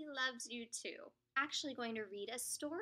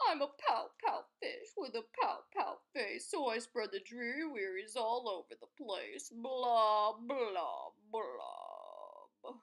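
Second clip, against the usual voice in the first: gloomy fish voice sinking to a low groaning blah; glum pout fish; 3 semitones lower, 4 dB louder, slower than usual. Acted. The pout fish moaning about his dreary wearies, glum. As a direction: drag it out like a sulky fish. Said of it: "glum, gloomy swimmer with an ever-present pout".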